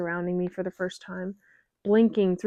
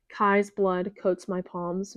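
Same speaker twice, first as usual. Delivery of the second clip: ordinary delivery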